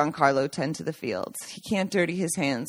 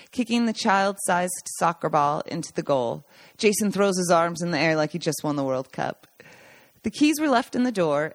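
plain reading voice

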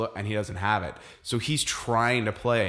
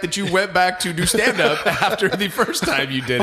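high, loud, laughing as you talk; amused teasing; 9 semitones higher, 6 dB louder than usual. Real, not acted. Ribbing a co-host about going back to stand-up, laughing. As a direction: tease through real laughter.

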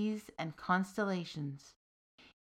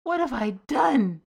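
higher and louder, a lament; bemoaning; 5 semitones higher, 7 dB louder than usual. Acted. Soldier laments freeing the demon, bemoaning loudly. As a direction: wail it in regret. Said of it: "bemoaned the soldier".

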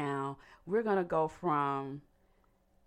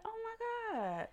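surprised; 7 dB quieter than usual. Retold or imitated. Reacting to seeing her program.

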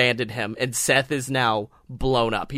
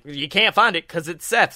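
ordinary talk, nothing marked

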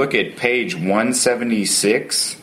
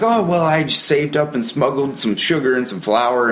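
offhand smuggler quote; 6 semitones higher than usual. Retold or imitated. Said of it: "they're like".

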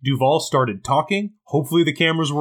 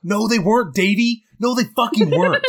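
mock retort; 8 semitones higher than usual. Jack snapping back at Davy, mock-outraged retort. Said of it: "what I wish the line was".

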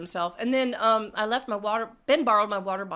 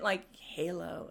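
dismissive Halo; 5 semitones lower, 9 dB quieter, slower than usual. Retold or imitated. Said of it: "He said".

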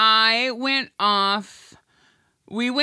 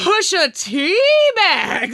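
grand reveal; 6 semitones higher, 5 dB louder, much slower than usual. Acted. Grand product pitch reveal.

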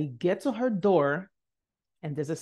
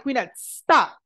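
higher, louder command to stop; firm interruption; 6 semitones higher, 7 dB louder, slower than usual. Retold or imitated. Professor halting the student's apology, loud and firm. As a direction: cut in sharply and firmly.

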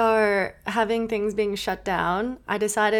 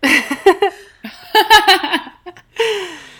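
high, loud burst of laughter; 9 semitones higher, 9 dB louder than usual. Real, not acted. Laughing at coach's retold answer.

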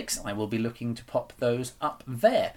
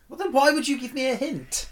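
high, louder incredulous outburst; exasperated; 13 semitones higher, 4 dB louder than usual. Real, not acted. Quiz player protesting to the host, exasperated. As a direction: pitch leaps up in exasperation.

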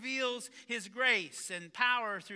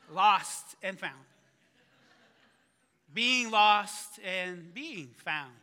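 slow emphasis; 7 dB louder, much slower than usual. Real, not acted. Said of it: "sermon theme stated slowly".

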